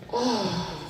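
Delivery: soft, hushed creepy voice; hushed, sleazy stranger asking; quiet creepy stranger. Retold or imitated. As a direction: lean in and murmur the question. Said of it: "I hear".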